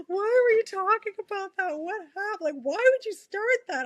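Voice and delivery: teary coworkers. Retold or imitated. Coworkers teary, asking what happened.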